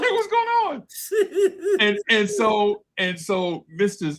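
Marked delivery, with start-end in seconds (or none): 0.0-1.8 very high, slow, laughing; laughing kid voice. Retold or imitated. Kid greeting his teacher, drawn out through laughter. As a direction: stretch it out while cracking up. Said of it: "It's like".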